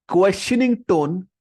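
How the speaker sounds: questioning drawn out slow and rising high; slow stressed term. Shown as a demonstration. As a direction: stretch the key term upward. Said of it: "Mirroring is simply".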